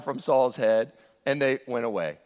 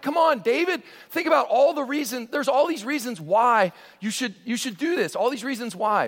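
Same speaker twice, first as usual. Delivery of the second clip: tempting urge; 8 semitones higher than usual. Acted. Voicing the tempter urging.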